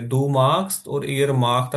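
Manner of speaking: very slow, word by word; slow demo. Shown as a demonstration. Teacher contrasting verb forms for students, very slow. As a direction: lay out each form slowly. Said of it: "ihr का लफ्ज इस्तेमाल करेंगे".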